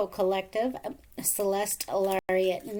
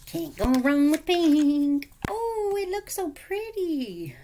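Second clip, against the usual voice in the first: excited; 7 semitones higher, 6 dB louder, slower than usual. Real, not acted. Gushing over pink.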